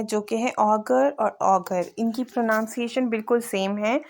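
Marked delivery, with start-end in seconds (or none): none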